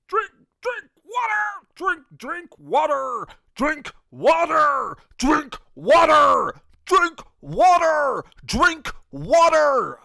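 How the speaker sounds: repeated high, slow drill sergeant screams; Drill Sergeant Thomas. Retold or imitated. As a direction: bellow each order in cadence. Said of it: "Drill Sergeant Thomas".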